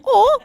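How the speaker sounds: high, loud "oh"; British sound imitation. Shown as a demonstration. Offering how British should sound, mock British exclamation. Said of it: "British would be like".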